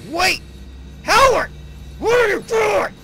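loud high angry yelling. Real, not acted. Player furious at partner Howard, yelling high. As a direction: scream at him to stop.

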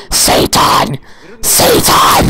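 sharp whispered shout; hiss-shout the name like a horror reveal; shouted Satan. Real, not acted. Gamer yelling at a devilish reveal, whispered shout.

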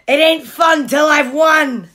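loud, punchy bragging; Pig's brash voice. A narrator's reading. Pig replying to Trevor about winning, loud brash voice. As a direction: belt it out like a competitive dog. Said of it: "that would be his voice".

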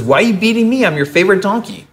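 high, wounded plea; a donkey pleading, lightly voiced and high; hurt talking donkey. Talking donkey pleads with Balaam, its beating owner, high and hurt. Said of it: "And the donkey says".

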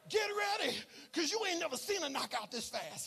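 loud, rising preacher's shout over music; preaching at full force. Acted. Pastor rallying the church to watch the fight, loud preaching. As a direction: hype the fight for God.

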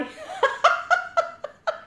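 a real high-pitched laugh breaking out; high burst of laughter. Real, not acted. (tiếng cười phá lên cao giọng)

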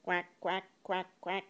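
short, evenly spaced quacks, pitched low; playful duck imitation. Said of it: "What's a ducky say?".

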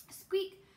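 high squeaky mouse sound; mouse squeak. A narrator's reading.

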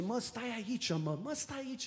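soft, hushed tempter coaxing; lightly voiced devil luring you to stay; devil's coaxing voice. The devil coaxing a believer to stay put, hushed. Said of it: "parcă cel rău zice".

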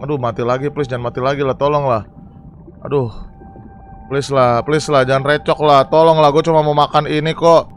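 low, plaintive begging; weary pleading. Real, not acted. Player begs to just eat without dying, pleading. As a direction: beg the game for mercy. Begs not to die again.

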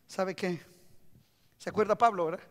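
usual speaking voice

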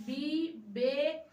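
বিবেকানন্দ said very slowly, syllable by syllable. Shown as a demonstration. Teacher showing the class how to say বিবেকানন্দ slowly. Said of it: "কিভাবে উচ্চারণ করছি দেখো".

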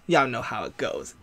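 hushed, low-pitched knowing aside; quiet aside. Real, not acted. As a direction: drop it low, almost to yourself. Aside, then repeated in usual voice.

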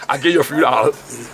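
ordinary delivery

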